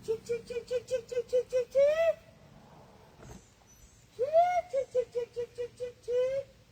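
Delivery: high sing-song chicken call. Real, not acted. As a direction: call the hens in high and light. Calling the chickens at feeding time.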